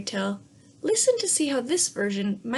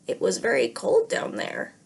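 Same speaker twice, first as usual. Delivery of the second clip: whispery unvoiced frog voice; breathy frog remark; 2 semitones lower than usual. Frog remarks to the princess after the dive, whispery. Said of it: "the frog remarked".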